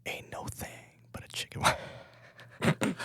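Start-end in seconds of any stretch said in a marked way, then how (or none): 0.0-1.8 ASMR whisper. Pretend. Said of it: "doing A S M R".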